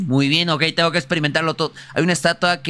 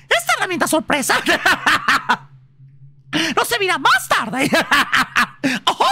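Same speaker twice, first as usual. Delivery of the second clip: laughing; 11 semitones higher than usual. Real, not acted. Laughing at item.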